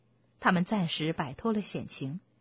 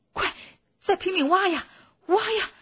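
high, loud, frantic urging; urgent rallying shout; 10 semitones higher, 6 dB louder than usual. Acted. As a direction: shout at the children as if lives depend on it. Fox urging children to dig. (焦急地高声催促)

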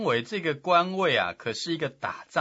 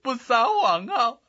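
higher, louder tearful begging; loud crying plea; 12 semitones higher, 4 dB louder than usual. Acted. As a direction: sob it out to the emperor. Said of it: "他哭得更大声了". (放声哭着劝谏)

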